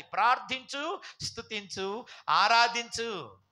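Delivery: loud, slow, drawn-out call; rally the crowd to worship; each word held long and loud; rousing exhortation. Real, not acted. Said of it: "preacher exhorts".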